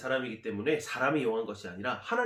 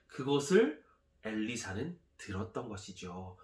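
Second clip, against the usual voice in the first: usual speaking voice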